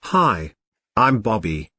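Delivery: ordinary delivery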